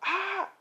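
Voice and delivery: short high-pitched pained cry; high yelp of agony; scream of pain. A narrator's reading. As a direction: let out a brief acted shriek. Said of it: "she screams in pain".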